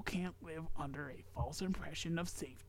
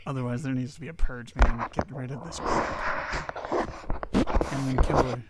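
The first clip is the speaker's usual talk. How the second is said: loud, fast mock outrage; angry rant; 11 dB louder than usual. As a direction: rant it out, half joking. Mock rant about a purge.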